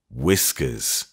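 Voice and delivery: firm, low, falling Whiskers; stern. A narrator's reading. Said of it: "he answered sternly".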